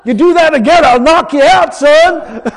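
loud, firm mama warning; stern mama threat. Retold or imitated. Preacher imitates his mama threatening her son, loud and firm. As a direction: threaten the boy plainly and loud. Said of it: "told you flat out".

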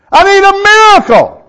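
high, loud cry of need; mock desperate shout, high and loud; pleading woman. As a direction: plead loudly like a desperate caller. Woman on phone demands healing.

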